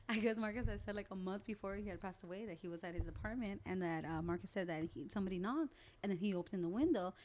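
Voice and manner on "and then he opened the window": ordinary delivery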